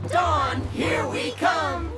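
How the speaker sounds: slow, drawn-out shout; eager call to the road ahead; rallying cry. Acted. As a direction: shout it out as you set off. Travellers set off, calling out.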